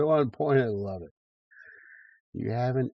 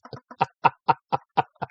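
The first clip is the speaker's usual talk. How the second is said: loud, high staccato laughter; hearty laugh; 6 semitones higher, 8 dB louder than usual. Real, not acted. Delighted by so many good points, loud laugh. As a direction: laugh hard in short high bursts.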